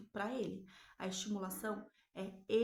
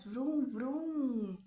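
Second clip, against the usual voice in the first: vrum, vrum de carrinho imitado como bebê, mais forte; faça o ronco do carrinho como um bebê; imitação de bebê; 3 semitones higher, 5 dB louder than usual. Retold or imitated. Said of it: "Aí o bebê faz". (imitando o bebê fazendo vrum)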